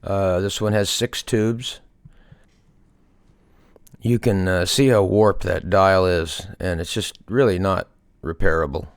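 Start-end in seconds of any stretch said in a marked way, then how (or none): none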